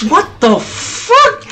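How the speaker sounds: high, drawn-out what the fuck; shocked. Real, not acted. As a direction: stretch out the disbelief. Shocked gamer reaction.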